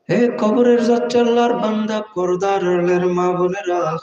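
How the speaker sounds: slow, melodic preacher impression with long-held endings; Pir of Charmonai. Imitating the Pir warning believers of the grave, slow chanting. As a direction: intone it like a sorrowful sung sermon, stretching final vowels. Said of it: "উনি দেখবেন চমৎকার সুরে".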